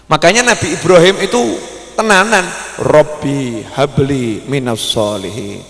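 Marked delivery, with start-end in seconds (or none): 2.8-5.6 lower, soft, very slow supplication; earnest prayer. Acted. Ibrahim praying to God for a pious child, soft and slow. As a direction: pray humbly and slowly.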